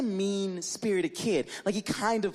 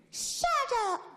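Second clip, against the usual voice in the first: put-on much higher bratty kid voice; bratty kid yell. Mean kid snapping at the babysitter, high and bratty. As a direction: snap it back like a spoiled child. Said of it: "And he goes".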